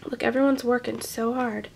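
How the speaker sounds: soft hushed words; soft whispered aside. Real, not acted. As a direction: drop to a whisper. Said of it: "aside to listener".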